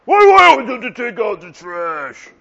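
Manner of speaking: mock rant at the partner about chores; mock angry spouse. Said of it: "they say something really intuitive".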